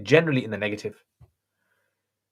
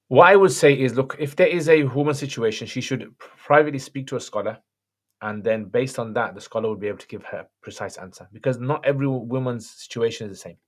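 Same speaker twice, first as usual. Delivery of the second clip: normal talking voice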